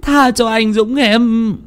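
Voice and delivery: high, slow desperate plea; earnest pleading. A narrator's reading. Sister begging the ghost to spare her brother, pleading. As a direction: beg the ghost for mercy. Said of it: "cất lời khẩn khoản".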